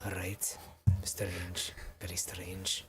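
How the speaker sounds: soft, half-whispered unease; hushed. Acted. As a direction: murmur it to yourself in the dark. Player alone in eerie silence.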